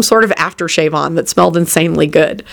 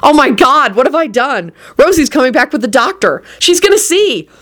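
higher-pitched dawning panic; horrified; 7 semitones higher than usual. A narrator's reading. William staring at his healed leg, horrified. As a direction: fret aloud as the horror climbs. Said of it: "stared at his leg in horror".

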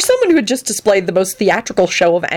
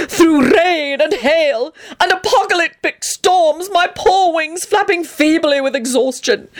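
high, overwrought tragic storytelling; milk every hardship with theatrical suffering; melodramatic lament; 8 semitones higher than usual. Acted. Antonin recounts his flight north, high melodramatic woe.

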